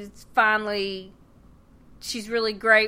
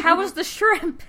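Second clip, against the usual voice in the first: cheery server; 8 semitones higher, 5 dB louder than usual. Retold or imitated.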